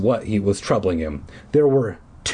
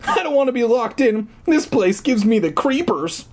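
put-on high, whiny frightened boy; scared little brother; 13 semitones higher than usual. A narrator's reading. Eddie, afraid of being locked in, whining high. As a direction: whine it like a kid who wants out.

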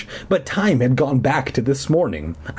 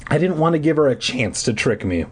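plain reading voice

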